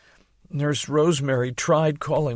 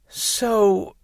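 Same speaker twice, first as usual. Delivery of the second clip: moaning; 7 semitones higher, 4 dB louder than usual. Acted. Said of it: "I moaned".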